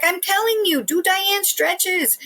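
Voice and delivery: high, drawn-out urging; imitated insistent mother. Mimics mother urging her friends.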